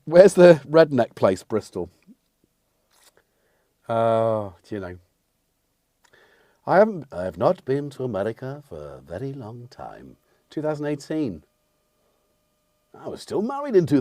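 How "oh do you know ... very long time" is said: lightly played American accent; put-on American accent. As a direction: slip into a mock American accent. Back into playing an American character.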